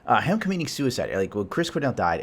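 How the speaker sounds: ordinary delivery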